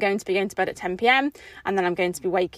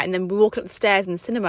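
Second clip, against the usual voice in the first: normal talking voice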